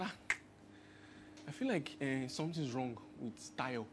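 soft, low, half-whispered concern; hushed worry. Retold or imitated. Father telling his wife he fears their son is sick, hushed. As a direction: murmur it like a worried dad at dawn.

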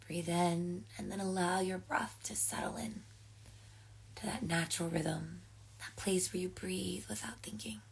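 guided breathing. Acted. Leads slow breathing exercise.

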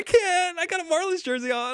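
high, fast whiny protest; high put-on voice, apologetic; mock-whiny excuse to a friend; imitating himself. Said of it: "I was like".

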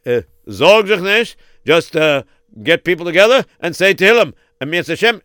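ordinary talk

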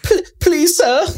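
very high, put-on little kid plea; squeaky-high mock orphan begging; sad begging child. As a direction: plead like a pitiful little door-to-door seller. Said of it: "I gave him that, that voice".

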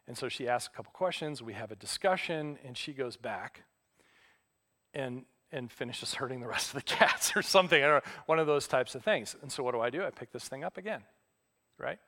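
ordinary talk

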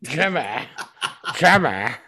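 a stretched, slurred gentleman; a lightly put-on catchphrase voice; gentleman soundbite. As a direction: deliver the catchphrase as his bit. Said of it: "a gentleman soundbite".

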